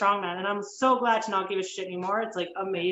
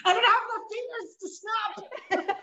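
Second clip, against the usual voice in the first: laughing; 10 semitones higher, slower than usual. Real, not acted. Laughing enthusiastic reaction.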